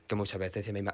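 ordinary delivery

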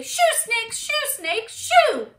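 high, loud repeated shooing. Shown as a demonstration. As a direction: shoo them off in loud bursts. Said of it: "Shoo the snakes away".

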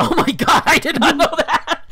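high, loud astonished outburst; real shock. Real, not acted. Host learning the author is from Wisconsin, loud shock. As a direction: cry out in embarrassed disbelief.

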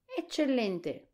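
high, slow, delighted exclamation; excited cry. A narrator's reading. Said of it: "gridò lo spaventapasseri".

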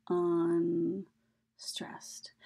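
"unstressed" drawn out, with a gap between "un" and "stressed"; syllable by syllable. Shown as a demonstration. Teacher explaining what the little U means, "unstressed" slowly split.

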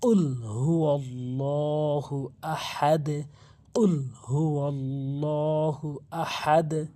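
slow, drawn-out tajweed chant; slow Arabic recitation; Quranic recitation. Shown as a demonstration. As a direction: recite it slowly with full lengthening.

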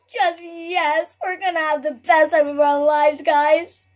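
ordinary talk

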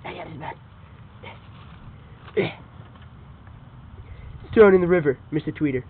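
low hushed villain voice; hushed dog villain. Acted. Dog reporting the finished job, low hushed voice. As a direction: murmur it like a brute after the deed.

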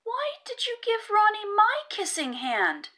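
put-on high, loud, pleading child's voice; jealous raccoon begging his mother for reassurance; hurt child. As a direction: demand an answer through tears. Upset child questions mother.

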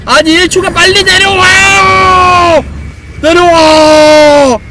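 long loud yell to come down; drawn-out shout. Real, not acted. Yelling at slow character.

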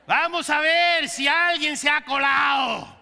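loud joking challenge; playful shout. Real, not acted. Preacher teasing the congregation to find the intruder, loud playful shout. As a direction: call it out to the whole room, teasing. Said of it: "joking challenge to congregation".

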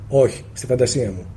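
a soft, sheepish confession; sheepish admission. Acted. Answering the teacher's question, softly and sheepishly. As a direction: own up quietly, a little embarrassed.

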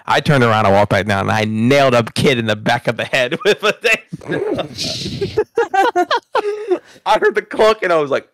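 ordinary delivery

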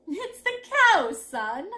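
amused, high drawn-out start; laughing mother. A narrator's reading. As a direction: brush it off with amusement.

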